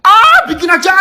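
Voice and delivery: high laughing urge. Real, not acted. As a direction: laugh while urging yourself. Laughing reaction.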